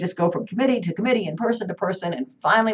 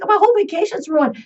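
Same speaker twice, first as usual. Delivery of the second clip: much higher, dismayed whine; 11 semitones higher, 5 dB louder than usual. Retold or imitated. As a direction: wail it in mock despair. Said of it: "I'm like".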